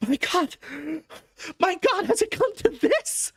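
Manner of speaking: high, pained man's cry; anguished Harker. A narrator's reading. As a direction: cry out in despair. Harker wakes to horror.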